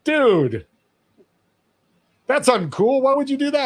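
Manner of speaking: imitating a shocked young clerk; mock disbelief. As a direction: act baffled at the stranger's kindness. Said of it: "looked at me like".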